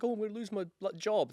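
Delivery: hammed-up sob story; mock whining. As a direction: whine like a self-pitying driver. If you're like.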